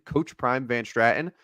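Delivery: normal talking voice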